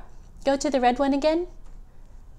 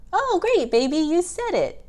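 high delighted "Oh, great"; excited praise; 7 semitones higher, faster than usual. Real, not acted. Praising the baby.

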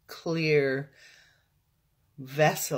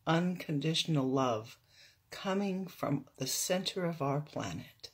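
guided meditation; 3 dB quieter than usual. Real, not acted. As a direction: guide them into stillness, unhurried.

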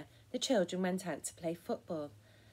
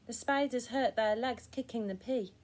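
ordinary delivery

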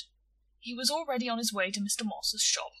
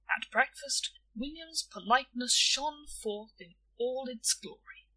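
ordinary delivery, nothing marked

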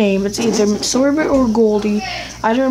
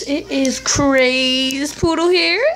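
ordinary delivery

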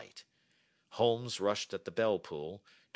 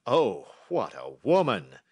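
loud, slow royal exclamation; emphatic admiring cry; 9 dB louder than usual. A narrator's reading. King overwhelmed by the clever lady, loud admiring cry. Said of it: "cried the King of Bohemia".

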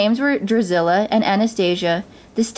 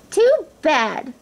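higher-pitched taunt; mocking stepsisters; 7 semitones higher than usual. A narrator's reading. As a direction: jeer with false pity.

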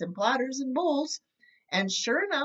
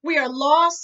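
loud, higher cry of doom; despairing cry; 5 semitones higher, 9 dB louder than usual. A narrator's reading. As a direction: wail it as if all hope is gone. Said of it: "said the people".

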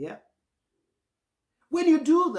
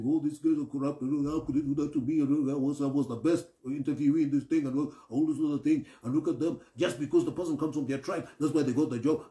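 grumbling complaint; 4 semitones lower than usual. Retold or imitated. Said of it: "leaving the place mumbling".

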